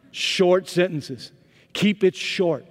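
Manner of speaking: each word laid out slowly; slow it down to show what short means; slow, deliberate advice. Retold or imitated. Pastor coaching the head coach on translators, slow and deliberate. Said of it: "Here, here's what I want you to know".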